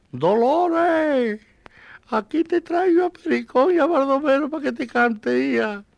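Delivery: high, loud cry to his dead wife; grieving widower calling. Retold or imitated. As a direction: call out to Dolores as if she could hear. Viudo llama a su mujer muerta. (viudo que llama a voces a su mujer muerta)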